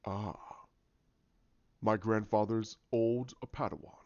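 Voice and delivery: a hint of sinister recognition; menacing villain. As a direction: savor it as a brooding villain. Villain recognizes his grandfather's apprentice.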